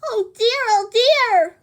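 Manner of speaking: high squealing pig, scared; frightened pig. Acted. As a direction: wail in panic. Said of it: "cried the pig".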